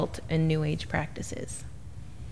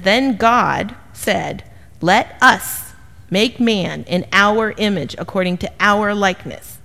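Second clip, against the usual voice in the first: slow scripture reading; 4 dB louder, slower than usual. Acted. Reading scripture slowly, emphatic.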